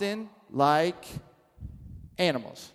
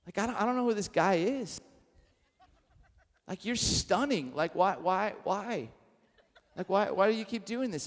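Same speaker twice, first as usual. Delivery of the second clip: soft, hushed pleading with the cat; tender to the cat; 5 dB quieter than usual. Retold or imitated. Man softly pleading with his pregnant cat, tender. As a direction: coax her gently, half laughing.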